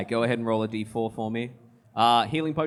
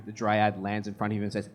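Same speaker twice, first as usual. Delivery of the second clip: usual speaking voice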